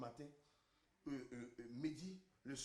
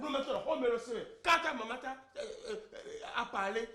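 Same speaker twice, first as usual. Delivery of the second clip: loud, raised-pitch protest; shouting; 6 semitones higher, 9 dB louder than usual. Acted. Husband protests about his wife's mother, shouting. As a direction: keep up the hollering. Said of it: "je vais crier".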